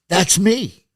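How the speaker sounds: high, eager claim of credit; retold burst of pride; all proud. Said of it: "I was all proud of it".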